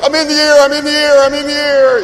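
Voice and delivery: high, loud panicked puppy voice; puppy's panic. Retold or imitated. Puppy being carried outside, high panicked voice. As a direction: squeal it like a startled puppy. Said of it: "he'll be like".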